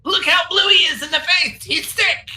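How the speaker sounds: high squeaky kobold; tattling little kobold, pitched high. Acted. Sir Dim voiced by GM.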